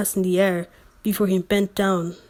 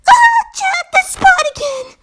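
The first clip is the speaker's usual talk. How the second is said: very high and loud cry; high-pitched scream; 8 dB louder than usual. Acted. Reader cries out to Jack in a high shriek. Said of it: "scream out in a high-pitched tone".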